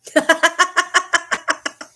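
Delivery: long high-pitched rattling laugh. Real, not acted. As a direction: burst into a high staccato laugh. Laughs during chat.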